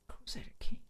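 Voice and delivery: whispered to self while searching; absent-minded whisper; under breath. Real, not acted. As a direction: murmur it very softly, barely voiced. Mumbles while searching for game.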